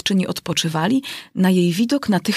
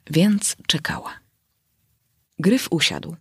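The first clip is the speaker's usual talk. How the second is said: whisper. A narrator's reading. Narrator whispers suspense.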